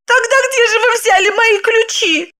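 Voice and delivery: high-pitched astonished question; surprised. A narrator's reading. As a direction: ask in a high, puzzled woman's voice. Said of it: "удивилась Загогулина". (удивлённо, высоким голосом)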